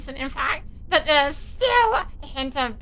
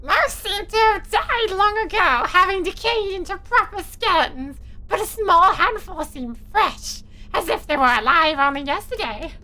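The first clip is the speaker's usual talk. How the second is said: higher, louder silly pumpkin narrator; 7 semitones higher, 3 dB louder than usual. Acted. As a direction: read it like a goofy pumpkin. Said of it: "forced me to speak in pumpkin voice".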